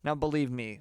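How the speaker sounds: ordinary talk, nothing marked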